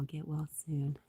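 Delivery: ordinary talk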